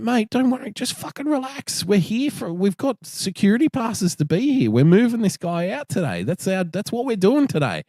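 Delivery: mock-soothe then brush him off; impatient boss. Teller quotes himself, impatient.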